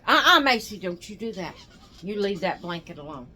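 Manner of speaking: sharp, loud warning that breaks off the chat; scolding call. Real, not acted. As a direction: stop Macy mid-sentence, firm and sudden. Scolding Macy for pulling blankets.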